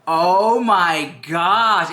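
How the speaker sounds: drawn-out, awed "oh my gosh"; gushing unboxing excitement, very slow; excited gushing. Real, not acted. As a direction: stretch it out slowly with excitement. Gushing over unboxed sponsor product.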